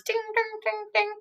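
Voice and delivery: high, soft ding ding of clashing swords; ping out the sword clangs; playful sword sound effect; imitated sword clang. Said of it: "so swords".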